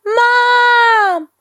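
put-on high, loud child's cry for mom; child yelling. A narrator's reading. Scared boy calls his mom, yelling. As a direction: yell for mom from bed. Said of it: "yelled Kipper".

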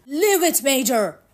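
high, loud, sharp command to a dog; shouted warning. A narrator's reading. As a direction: call out an urgent warning. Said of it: "warned Matt".

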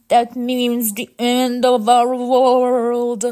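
loud, slow robot wail; loud, drawn-out cry of despair; doom-struck. Acted. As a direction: draw out the dreadful news.

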